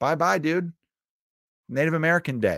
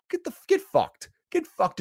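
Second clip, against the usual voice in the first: much higher squealed put-down; mock squeal. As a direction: shriek it as a cartoonish taunt. Mocking the sad fans.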